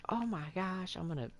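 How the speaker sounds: high, frantic mock wife voice; panicked wife. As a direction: imitate her flustered panic. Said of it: "she just immediately was like".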